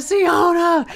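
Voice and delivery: high, loud call of her name; popping out of a dumpster to surprise her, shouting. Retold or imitated. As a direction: leap out and yell her name. Jumps out of dumpster calling her.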